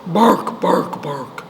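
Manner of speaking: falling mock-dog barks. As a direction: bark like a real dog. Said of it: "Sounds more like a dog".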